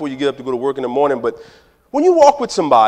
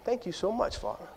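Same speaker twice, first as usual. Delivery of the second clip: very soft, grateful aside; hushed thanks; 2 semitones higher, 17 dB quieter than usual. Acted. Believer thanking Father for the check, hushed. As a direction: drop to a near-murmur of thanks.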